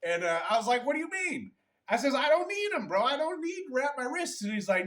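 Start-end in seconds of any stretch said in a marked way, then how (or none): 0.8-1.5 high-pitched, incredulous retort. Retold or imitated. Retelling his disbelieving reply to fighter. 2.1-4.4 high, loud mock-incredulous outburst; incredulous protest. Retelling a disbelieving exchange about wraps.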